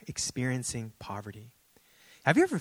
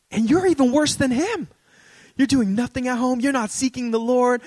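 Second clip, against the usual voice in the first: much higher, louder mock scolding; scolding prophet; 11 semitones higher, 8 dB louder than usual. Lady prophesying over the pastor, loud mock scolding. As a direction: tear into him like an overzealous prophet. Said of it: "She says".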